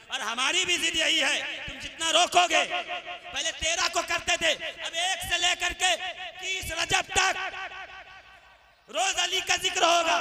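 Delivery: loud, high, building defiance; defiant preaching. Acted. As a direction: throw the vow at those who block you. Defiant climax of sermon. (ज़िद भरी ऊँची आवाज़ में ऐलान)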